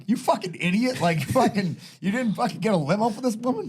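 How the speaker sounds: high-pitched mock outrage; hammed-up, incredulous scolding; indignant Boston guy. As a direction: imitate a Boston guy berating his buddy. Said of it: "like, Boston guy".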